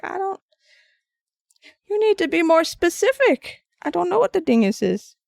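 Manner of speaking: asking grandma to be specific; exasperated kid self. Retold or imitated. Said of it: "And I'm like".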